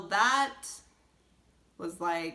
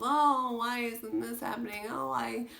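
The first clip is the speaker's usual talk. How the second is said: pitched-up self-pity whine; whining; 5 semitones higher than usual. Retold or imitated. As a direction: whine it to yourself like a sulky kid. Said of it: "complaining and whining to myself".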